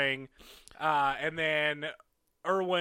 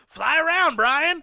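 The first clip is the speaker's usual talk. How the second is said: high, loud mock wrestler's yell; yelling taunt; 10 semitones higher, 7 dB louder, slower than usual. Podcaster mimics wrestler heckling Flying Brian mid-match, yelling. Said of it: "he would yell".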